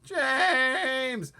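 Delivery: high, loud drawn-out call; bawling. Acted. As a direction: bawl his name for help. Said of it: "bawled the centipede".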